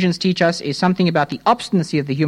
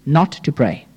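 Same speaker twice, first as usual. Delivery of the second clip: ordinary talk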